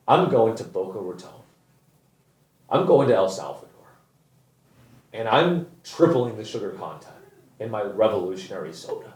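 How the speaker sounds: lightly voiced founder bragging; mock sellout founder. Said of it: "she's like".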